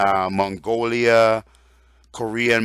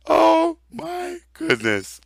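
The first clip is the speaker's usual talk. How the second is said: slow and much higher, still amused; real amused disbelief, drawn out high; laughing disbelief; slower than usual. Real, not acted. Preacher recovering from laughter, slow high amused.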